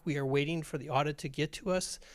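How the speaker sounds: usual speaking voice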